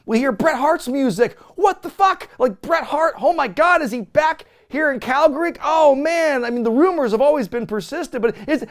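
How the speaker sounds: high, loud hyped rush; reacting to Bret Hart's music; real excitement. Real, not acted. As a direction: sell it like a stunned fan.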